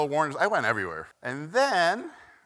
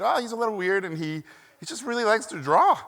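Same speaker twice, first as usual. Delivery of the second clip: lightly voiced doting relative, higher pitch; affectionate family member; 7 semitones higher than usual. Family explaining their odd kid, affectionate higher voice. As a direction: lift it higher, gently excusing the child.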